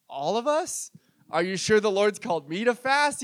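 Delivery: acted doubtful protest, rising at the end; reluctant disbelief. Staff questioning the pastor's fasting plan, dubious.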